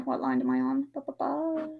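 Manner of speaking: ordinary delivery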